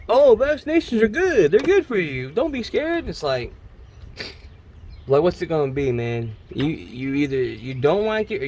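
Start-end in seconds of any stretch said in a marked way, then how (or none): 0.1-3.1 much higher, sugary mock reassurance; mocking the billionaire's reassuring tone; mocking Bill Gates. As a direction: parody a rich man soothing the public. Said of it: "he's saying".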